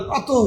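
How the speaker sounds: "প্রাতঃ" with a breathed h at the end; বিসর্গে হ উচ্চারণ. Shown as a demonstration. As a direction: voice the visarga of "প্রাতঃ" as an h sound. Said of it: "বিসর্গের পরিবর্তে আমরা হ উচ্চারণ করব". ("প্রাতঃ"-এর বিসর্গে হ ধ্বনি)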